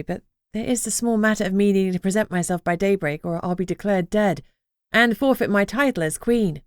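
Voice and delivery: ordinary delivery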